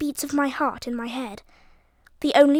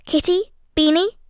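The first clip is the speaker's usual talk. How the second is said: calling out; 5 semitones higher, 4 dB louder than usual. A narrator's reading. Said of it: "called Daisy".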